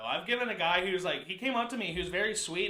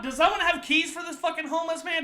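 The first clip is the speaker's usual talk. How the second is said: high, raised mock-desperate call; exasperated plea; 10 semitones higher, 7 dB louder, slower than usual. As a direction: call out for help, half laughing. Comic plea to silence shouting.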